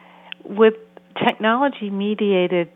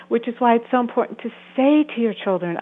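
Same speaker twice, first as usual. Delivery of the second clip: ordinary talk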